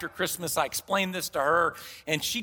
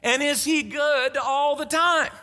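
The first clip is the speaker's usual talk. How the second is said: incredulous patient; 5 semitones higher, 3 dB louder, slower than usual. Retold or imitated. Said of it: "And I go".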